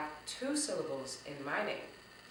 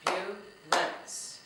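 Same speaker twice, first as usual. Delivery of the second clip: "Ambulance" slowly split into three separate beats; very slow syllables; 4 dB louder, much slower than usual. Shown as a demonstration. Teacher repeating the clapping exercise, "Ambulance" said very slowly in syllables. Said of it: "Let's try that again".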